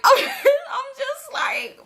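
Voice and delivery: high, drawn-out words through a laugh. Real, not acted. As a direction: let real laughter stretch the words. Laughing as she retells her reaction.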